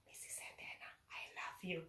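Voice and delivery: secret whisper in someone's ear. Retold or imitated. Former pupil whispers to teacher on way to cafeteria, hushed whisper. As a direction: whisper it softly, imitating a shy confession. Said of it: "whisper to my ear".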